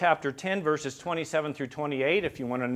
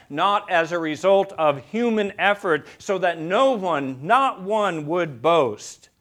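ordinary talk, nothing marked

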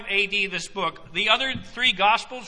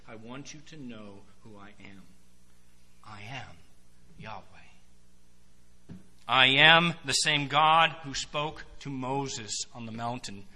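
tender reassurance; 7 semitones lower than usual. Acted. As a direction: speak it close, like comforting a friend. Jesus reassuring disciples before trial.

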